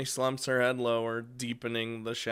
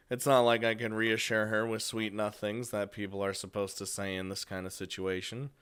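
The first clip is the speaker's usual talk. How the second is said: ordinary talk, nothing marked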